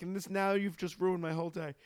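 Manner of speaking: mock meltdown voice. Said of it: "I'm like".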